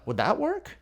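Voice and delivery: high, lilting question rising on "work"; reasonable man tonality. Shown as a demonstration. Salesperson testing the close with a prospect, reasonable and light. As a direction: ask it as a harmless, reasonable suggestion. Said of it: "He calls it the reasonable man tonality".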